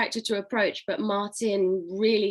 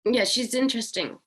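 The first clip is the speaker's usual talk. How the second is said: ordinary talk, nothing marked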